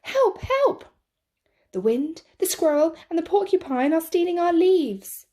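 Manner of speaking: the cub begging the forest for help; crying out for help. Acted. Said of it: "cried Fletcher".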